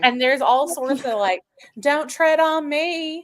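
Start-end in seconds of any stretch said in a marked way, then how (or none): 1.8-3.2 slow Texas drawl; Southern accent. Shown as a demonstration. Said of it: "what I sound like when I'm not neutralizing my accent".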